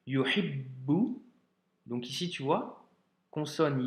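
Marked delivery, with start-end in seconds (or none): 0.1-1.2 slow yuhibbu, holding the doubled b. Shown as a demonstration. Teacher reading the second sentence, yuhibbu with doubled b. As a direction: hold the bb in yuhibbu, then release a long u. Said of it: "on doit doubler la lettre ba".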